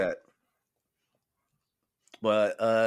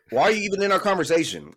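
loud, high-pitched indignant retort; retold outburst, voice pushed up and louder; retold upset; 7 semitones higher, 5 dB louder than usual. Shopper confronting a stranger who butted in, upset. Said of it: "I was really upset".

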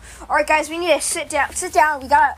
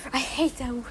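ordinary talk, nothing marked

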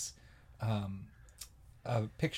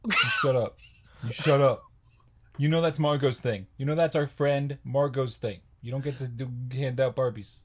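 loud, half-joking telling-off; playfully indignant, raised voice; mock scolding; 6 dB louder than usual. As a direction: scold a friend in mock outrage. Mock quarrel over the Barbie bit.

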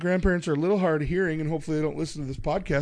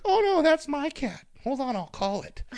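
grandma; 10 semitones higher than usual. Retold or imitated. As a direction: put on a high grandma voice. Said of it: "my grandma's like".

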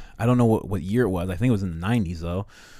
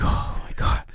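stunned whisper. Retold or imitated. Imagined MLK hears of police killing.

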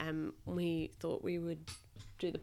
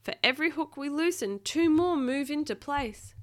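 higher-pitched, strained report; growled complaint; 8 semitones higher, 6 dB louder than usual. A narrator's reading. Captain struggling with the hooks, mildly growled complaint. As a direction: report the setback with effort. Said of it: "growled the captain".

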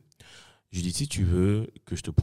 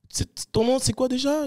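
imitating the guy. Guy at party asks his name.

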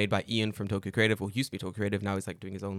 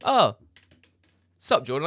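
bright, louder call of hello; mock excited greeting; 3 semitones higher, 7 dB louder than usual. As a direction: greet a friend across the room. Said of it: "people go".

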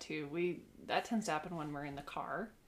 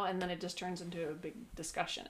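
usual speaking voice

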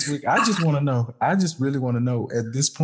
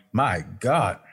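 usual speaking voice

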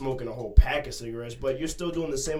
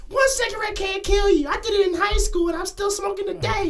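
high caller voice; 6 dB louder than usual. Pretend. Imagined caller phones the show.

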